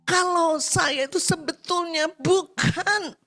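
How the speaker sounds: high, drawn-out plea to God, acted out; desperate prayer. Frightened maid prays in the bathroom, pleading high and slow. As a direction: plead slowly upward, building to a high wail of fear. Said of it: "dia berdoa di kamar mandi".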